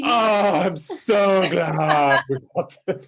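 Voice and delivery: high, loud, drawn-out gush; mock thrilled husband. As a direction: overdo the relief, high and slow. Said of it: "You were so thrilled".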